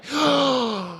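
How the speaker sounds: a high, hammed-up gasp of shock; dramatic gasp. Streamer gasps in mock shock at the chat. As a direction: gasp as if scandalized.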